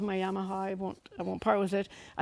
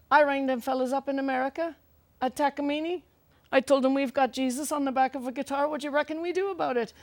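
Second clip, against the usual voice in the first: higher-pitched imitation of a Cork accent; 4 semitones higher than usual. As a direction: play the shopkeeper in a lilting Cork accent. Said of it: "doing my best Cork accent".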